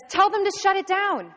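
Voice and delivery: loud, high yell; shouted emergency instruction; urgent order. Acted. George yells orders in emergency.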